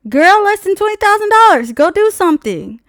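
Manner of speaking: loud, high, dismissive comeback; sassy retort. Retold or imitated. Snapping back at the salesman, loud and sassy. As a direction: shut him down with attitude.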